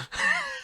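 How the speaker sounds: quick high laugh after the joke; short laugh. Real, not acted. Host laughing after a cheeky Patreon plug. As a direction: chuckle at your own gag.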